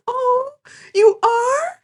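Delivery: high, teary, overjoyed gasp; teary anime gush. Retold or imitated. Adora moved to tears by Catra, a high teary gush. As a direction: gush like an anime girl with tears in her eyes. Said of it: "full anime ... tears in her eyes".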